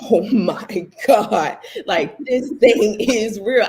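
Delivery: slow, loud, amazed laugh; retold astonishment. New believer stopped in her tracks by answered prayer, astonished. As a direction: drag it out in stunned delight.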